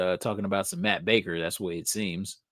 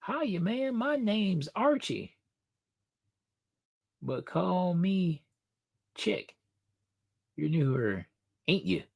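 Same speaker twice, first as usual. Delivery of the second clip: high, soft country Southern drawl; comic-book Southern country boy, pitched up; Southern accent; 7 semitones higher, 6 dB quieter, slower than usual. Acted. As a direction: read it slowly with a little country twang. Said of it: "like, a Southern accent here".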